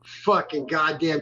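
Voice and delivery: ordinary talk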